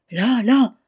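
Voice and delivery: startled shout; crying out. A narrator's reading. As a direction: cry it out loud as if pointing at something dreadful. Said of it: "pousser un grand cri".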